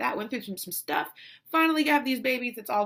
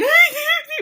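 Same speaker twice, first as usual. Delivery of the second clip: high, loud fake sob; hammed-up burst of wailing; mock bawling wail; 12 dB louder than usual. Mother denying she is bawling, mock loud wail. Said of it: "I'm not bawling, like".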